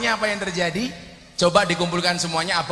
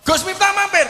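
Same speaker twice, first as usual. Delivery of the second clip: excited women calling; 12 semitones higher, 7 dB louder than usual. Retold or imitated.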